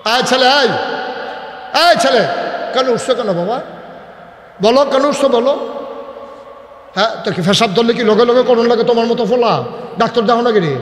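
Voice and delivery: high, loud telling-off; real scolding. Real, not acted. Preacher to a boy getting up mid-sermon, high scolding.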